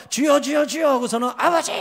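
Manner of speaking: high urgent cries to God; imitated fervent prayer. Pastor imitating students praying for the Spirit, high fervent calling. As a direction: cry out as a praying youth group would. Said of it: "하고 기도를 합니다".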